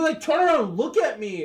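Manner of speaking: usual speaking voice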